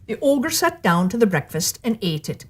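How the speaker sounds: ordinary delivery, nothing marked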